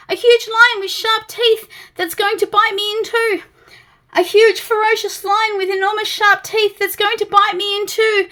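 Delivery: high, loud, fussy terror; a nervous little creature wailing in alarm; panicky Mister Jelly. Acted.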